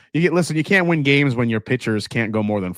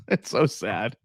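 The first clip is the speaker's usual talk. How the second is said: slow, softer mock lament; mock mournful; 3 semitones lower, 5 dB quieter, slower than usual. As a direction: drag out "It's so sad" in fake grief. Repeats the lament slowly and softly.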